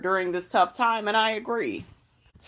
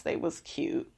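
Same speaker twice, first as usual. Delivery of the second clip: whispered aside; 5 semitones lower, 11 dB quieter than usual. Real, not acted. Whispered aside on purple boots.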